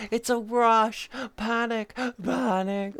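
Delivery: high, slow thrilled exclamation; playful excited cry. Pretend. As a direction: stretch it out with glee.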